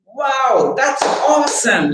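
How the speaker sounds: high, bright burst of delight; excited wow. Shown as a demonstration. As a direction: let the joy explode upward. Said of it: "interesting thing they say".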